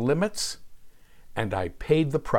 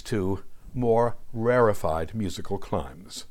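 normal talking voice

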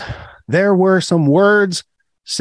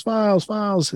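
word repeated like a fading echo; mock echo; 5 semitones higher, 5 dB quieter than usual. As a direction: let each repeat trail off softer. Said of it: "It goes on forever".

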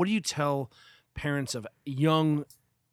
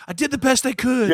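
higher, louder defensive mom imitation; mock mom voice; 10 semitones higher, 10 dB louder than usual. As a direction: play the hurt mother, loud and high. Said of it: "And she's like".

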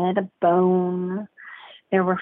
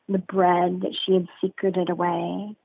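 ordinary delivery

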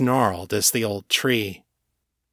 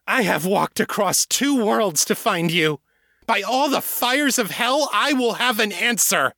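desperate angry outburst; 10 semitones higher than usual. A narrator's reading. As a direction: fling it out in anger and frustration. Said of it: "voice rose in pitch and volume".

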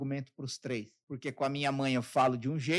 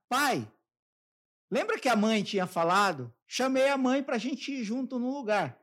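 eager pitch to dad; 8 semitones higher, 5 dB louder than usual. Retold or imitated. Son pitching trip to dad.

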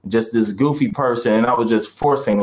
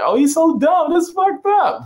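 high-pitched audience voice; squeaky mock disbelief; mock amused reaction. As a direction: imitate a tickled crowd, pitched way up.

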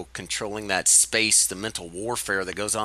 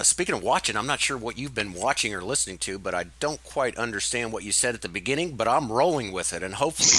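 ordinary talk

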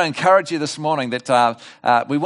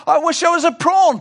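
much higher, louder pleading wish; lonely shark begging to be a prawn again; desperate wish; 12 semitones higher, 5 dB louder than usual. Acted. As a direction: wish it with all your heart.

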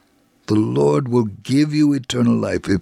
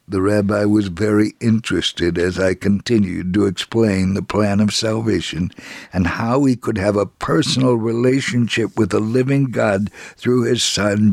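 ordinary talk, nothing marked

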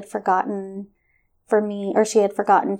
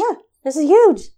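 emphatic agreement; 7 semitones higher, 7 dB louder than usual. Real, not acted. Agreeing, echoing host.

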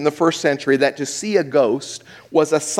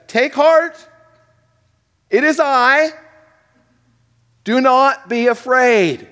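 Jesus commanding; 9 semitones higher, 4 dB louder than usual. Acted. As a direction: call out to the terrified men, much higher and louder.

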